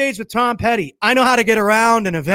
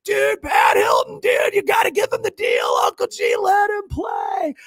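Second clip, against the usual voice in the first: high, strained calling out; imitated crowd pleading; 11 semitones higher than usual. Onlookers begging Cardone for a chance, high cheering shout. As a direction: mimic excited fans egging him on.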